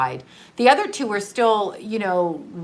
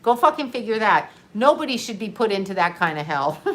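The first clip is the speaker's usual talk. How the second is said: usual speaking voice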